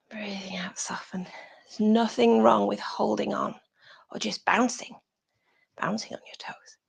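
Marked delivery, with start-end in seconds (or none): 0.1-1.3 hushed, sinking into softness; soft exhale cue. Shown as a demonstration. Yoga teacher easing the class into an exhale, softly. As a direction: let the voice soften with the breath.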